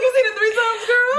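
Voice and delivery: high squeal through laughter; incredulous, cracking up; laughing disbelief. Retold or imitated. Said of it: "I'm like".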